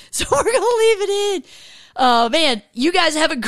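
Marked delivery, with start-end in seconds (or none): 0.0-1.4 laughing. Real, not acted. Laughing over his slip.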